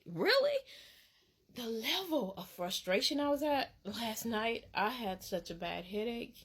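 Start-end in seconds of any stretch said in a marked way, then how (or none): 0.1-0.6 high incredulous really. Real, not acted. Indignant at theft.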